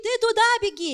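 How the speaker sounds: high-pitched mock shout; imitated father's yell. Another father directing the child at the game, high imitated yell. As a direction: yell like a rival dad from the sideline. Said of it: "Другой папа кричит".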